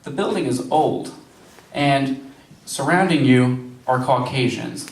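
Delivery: normal talking voice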